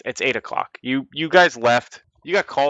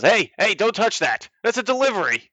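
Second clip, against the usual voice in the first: high, loud flustered sailor; alarmed sailor; 10 semitones higher, 4 dB louder than usual. Acted. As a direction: yelp at him to keep off the cargo.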